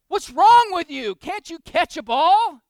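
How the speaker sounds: high, loud, slow exasperated shout at his son; angry yell. Retold or imitated. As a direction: bark at the kid who keeps dropping it. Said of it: "starting to get a little angry".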